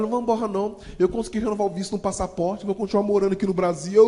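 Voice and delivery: tearful, relieved good news; tearful reassurance. Retold or imitated. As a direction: reassure him through tears. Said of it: "Ela começou a chorar".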